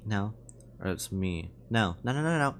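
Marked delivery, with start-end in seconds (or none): 0.9-1.5 very low, hushed try at a weary man; tired voice. Shown as a demonstration. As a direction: drop it down for a tired old guy. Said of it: "a tired voice".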